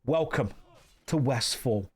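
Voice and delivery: light mock Cockney British accent; a hint of a London geezer's British accent; Danny Dyer. A streamer narrating his game to chat, light mock Cockney British accent. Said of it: "Danny Dyer impression stream".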